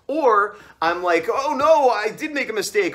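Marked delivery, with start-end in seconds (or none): 1.3-2.9 mock dismay. Acts out his usual worried reaction.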